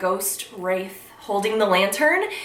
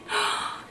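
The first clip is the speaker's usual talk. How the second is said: soft gasp; 6 dB quieter than usual. Real, not acted. Admiring figurine details.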